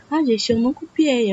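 ordinary talk

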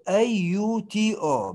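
"A U T O" spelled slowly, letter by letter. Shown as a demonstration. Teacher slowly spells "A U T O" for students. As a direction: spell out "A U T O" one letter at a time. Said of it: "spelling the prefix".

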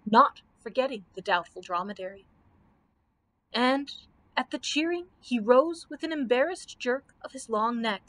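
usual speaking voice

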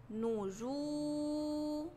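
very slow "نجوم", the ju held long on one note; long drawn-out vowel. Shown as a demonstration. Teacher gives damma example, "نجوم" with oo greatly prolonged. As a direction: stretch the oo in "نجوم", then a separate mu. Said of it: "لو عليها ضمة زي كلمة".

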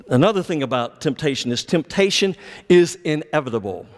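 ordinary talk, nothing marked

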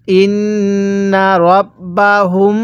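"ইন্না রব্বাহুম" recited slowly with long nasal hums on nn and final m; ghunnah. Shown as a demonstration. Teacher showing how to read it, "ইন্না রব্বাহুম" with sustained ghunnah. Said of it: "আপনিও সেখানে গুনাহ করবেন".

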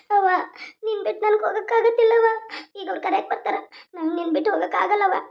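a daughter who can't bear to leave, close to tears; tearful bride. Acted. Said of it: "ನೀ ಹಿಂಗೆ ಅತ್ತಂದ್ರ". (ಅಳುತ್ತಾ ಅಮ್ಮನನ್ನು ಬಿಡಲಾರೆ ಎನ್ನುವ ಮಗಳು)

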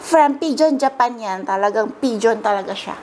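ordinary talk, nothing marked

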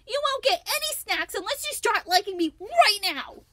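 put-on high, loud demanding girl voice; bossy threat. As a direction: scold them into liking her. Lucy threatening monkey.

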